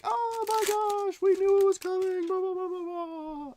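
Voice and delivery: shrill-free high mocking falsetto of a breaker. Mocking a card breaker who pulled a big hit, in a high put-on voice. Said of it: "and they're like".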